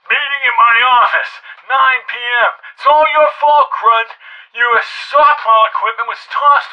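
irate officer chewing someone out; indignant dressing-down; angry colonel. Acted. Colonel berates Sam by voicemail.

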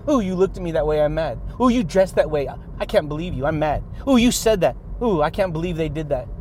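mocking easily offended people, lightly whiny; mock offended whiner. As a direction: mimic a touchy person griping. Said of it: "the ones who are getting offended by everything".

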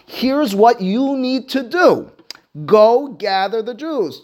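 slow, high, emphatic orders; commanding. Acted. Esther sends instructions back to Mordechai, taking command. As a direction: lay down the order word by word.

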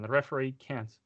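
normal talking voice

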